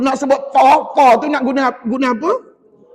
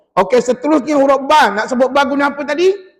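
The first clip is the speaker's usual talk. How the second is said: normal talking voice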